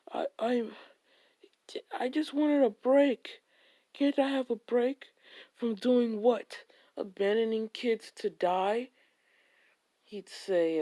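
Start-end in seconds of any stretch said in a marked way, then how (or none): 0.1-0.7 stammering whisper; frightened whisper. Acted. Beaten dude stammers in fear. 1.9-8.9 higher, louder, begging for a break; cornered hero crying out to be left alone; high pleading cry. Acted. Beaten dude pleads, Bo retorts.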